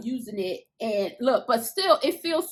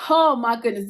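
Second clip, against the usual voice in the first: normal talking voice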